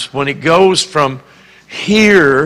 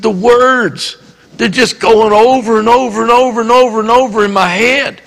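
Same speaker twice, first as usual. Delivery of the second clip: put-on agitated boy; 7 semitones higher, 3 dB louder than usual. Boy telling preacher about obsessive words.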